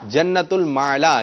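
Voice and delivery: "المعلا" slowly as ul-maa-laa, syllables drawn out; correct pronunciation. Shown as a demonstration. Host teaching the children the name, careful correct pronunciation. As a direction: model "المعلا" slowly with long open vowels. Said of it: "درست تلفظ کیجیے".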